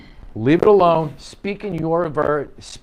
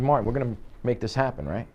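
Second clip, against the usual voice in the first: softer, quick and confidential; level, low-key approach; 3 semitones lower, 5 dB quieter, faster than usual. Shown as a demonstration. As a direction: say it casually at the client's level. Seated at client's level, demo.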